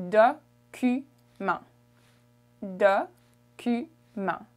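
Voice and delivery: document slowly in French, dokumon with a nasal end; French pronunciation. Shown as a demonstration. Teacher modelling document for travellers. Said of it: "It's un".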